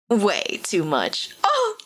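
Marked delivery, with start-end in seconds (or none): 1.4-1.8 swooning squeal. Real, not acted. Swooning over the hot character.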